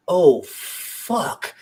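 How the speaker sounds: high, breathless retold gasp of shock; a sudden breathy whispered oh fuck; stunned realization. Band members realizing who the kid's idol is, breathless shock. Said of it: "we all look at each other and we're like".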